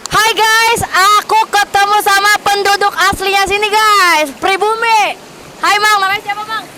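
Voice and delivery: bright, loud, high vlogger hello; excited greeting. Real, not acted. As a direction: greet the camera full of energy.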